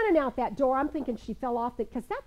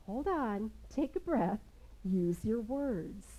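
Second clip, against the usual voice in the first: calm; 2 semitones lower, 7 dB quieter, slower than usual. Shown as a demonstration. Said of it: "that calm mother's presence".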